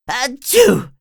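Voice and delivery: loud high acted sneeze; big sneeze. SpongeBob sneezing at work, loud cartoon sneeze. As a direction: let the sneeze explode.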